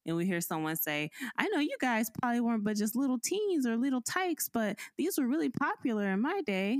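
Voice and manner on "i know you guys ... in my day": lightly higher chirpy fake-friendly voice; a hint of a nosy saleswoman, higher and sweet; mimicking the greeter lady. Greeter lady's intrusive remark.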